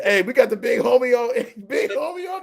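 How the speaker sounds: high, loud hype call; hyped greeting. Pretend. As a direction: call out like announcing an arrival. Hailing the big homie.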